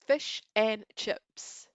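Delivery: stretched slowly, stress on "and"; slow drawn-out Aussie. Shown as a demonstration. Asked to say it, slow careful take. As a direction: draw out "Fish and chips" slowly. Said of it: "Say fish and chips".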